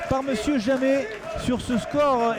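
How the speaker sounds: normal talking voice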